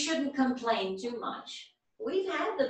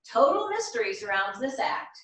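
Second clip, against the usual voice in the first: louder showman's introduction of the next act; announcer intro; 7 dB louder than usual. Acted. Host announcing Miss Emily to the talent show crowd, loud and showy. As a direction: build up the mystery like an emcee.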